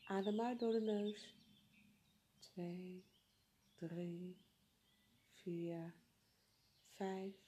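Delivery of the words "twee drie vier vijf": slow, much softer counting. Acted. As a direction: count the breaths softly, spaced out. Counting breaths softly.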